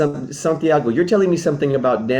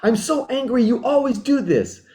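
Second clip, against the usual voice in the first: upset partner; 9 semitones higher than usual. Acted. Partner who waited on Tuesday complains, mock-hurt and high. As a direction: play the stood-up date, half-hammed complaint. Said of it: "you're so pissed off at me. You're like".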